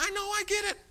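high-pitched, worn-down acknowledgement; exasperated agreement. Retold or imitated. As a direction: concede it in a high voice. Acting his exasperated agreement.

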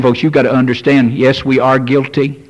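normal talking voice

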